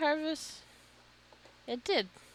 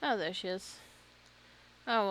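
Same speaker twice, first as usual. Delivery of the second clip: ordinary talk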